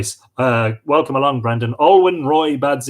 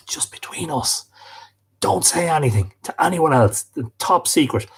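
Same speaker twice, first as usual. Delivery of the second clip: mock-secretive stage whisper; conspiratorial whisper. Host lets viewers in on a top secret, conspiratorial whisper.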